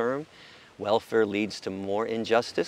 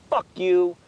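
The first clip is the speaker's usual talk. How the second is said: loud, high snapped curse; mock angry retort; 7 semitones higher, 7 dB louder than usual. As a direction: spit it at them. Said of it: "I'd say".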